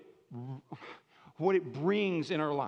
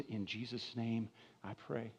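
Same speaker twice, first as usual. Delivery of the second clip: quiet prayer; 6 semitones lower, 10 dB quieter than usual. Acted. Closing prayer.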